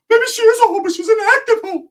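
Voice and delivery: very high, loud cartoon voice; squeaky put-on comeback. Cousin arguing back about his prima, squeaky put-on voice. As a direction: squeal it as an exaggerated impression.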